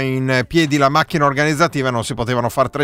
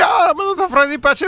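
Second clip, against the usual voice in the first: much higher, mocking a listener; 12 semitones higher than usual. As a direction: squeak it out like a naive listener. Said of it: "quelle cose che uno dice".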